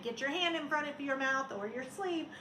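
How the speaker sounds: usual speaking voice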